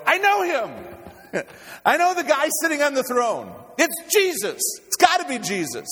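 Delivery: very high, loud yell of recognition; screaming excitement, long stretched words; ecstatic shout. Acted. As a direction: shout it out, overjoyed to know who it is. Acts out excited recognition of Jesus.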